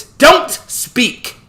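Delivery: loud, very high angry order; barked command. A narrator's reading. Artemis cuts Joshua off, barked command. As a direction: bark it out, cutting him off. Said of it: "she barked".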